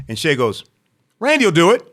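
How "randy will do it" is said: high, loud imitated call; volunteering a friend. Friend volunteers him to club owner.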